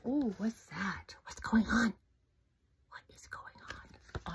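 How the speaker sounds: hushed curious whisper. Real, not acted. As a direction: whisper it wide-eyed. Hushed suspense aside.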